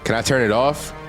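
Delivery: ordinary talk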